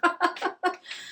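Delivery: high burst of laughter; surprised laugh. Real, not acted. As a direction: laugh at the irony of finding it. Finds the lost calendar page.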